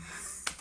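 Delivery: short, very soft nervous laugh. Real, not acted. To viewers, laughing off her emotion with a soft laugh. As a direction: laugh it off quietly, almost under the breath.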